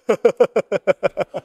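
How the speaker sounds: real chuckle in quick bursts; amused, staccato laughter; laughing. Real, not acted. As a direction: laugh at your own joke. Laughs at own joke.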